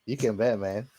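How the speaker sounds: ordinary talk, nothing marked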